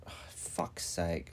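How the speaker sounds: muttered under breath; whispered curse. Real, not acted. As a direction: whisper it in frustration. Frustrated aside under breath.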